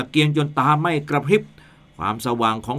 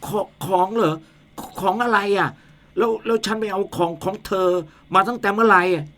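higher, stuttering frightened voice; scared stammering; 7 semitones higher than usual. Acted. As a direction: stammer in rising panic. Man questions ghost.

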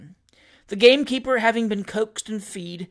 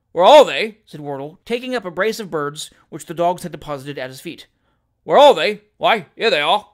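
scornful echo; 9 dB louder than usual. Acted. Host holding shot birds answers novice, scornful echo. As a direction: throw the question back at full volume.

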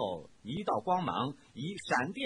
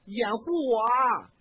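loud, high panicked shout; 7 semitones higher, 7 dB louder than usual. A narrator's reading. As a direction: yell for cover while running. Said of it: "一边大喊道".

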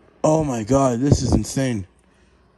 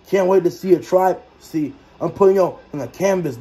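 ordinary delivery

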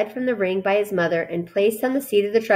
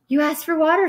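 higher, meek little voice; lightly apologetic protest; 8 semitones higher than usual. A narrator's reading. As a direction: offer it as a timid excuse. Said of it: "said Lurvy meekly".

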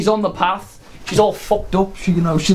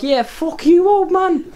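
put-on high, sneering teenage brat; much higher brat mocking the old man; bratty teen voice; 10 semitones higher, slower than usual. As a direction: squeak it out like a cocky kid. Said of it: "he's all like".

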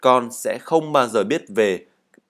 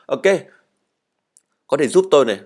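ordinary talk

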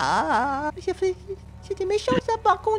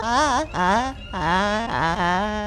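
fake crying; 12 semitones lower, 4 dB quieter, much slower than usual. Prank: fake crying continues.

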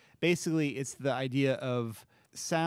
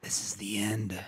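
hushed, breathy whisper of the end; 4 semitones lower than usual. Acted. Corbin whispers to his dying foe, hushed. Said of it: "Corbin whispered".

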